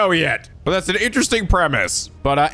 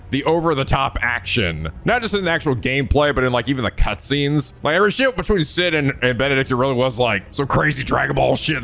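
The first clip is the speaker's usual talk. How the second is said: ordinary delivery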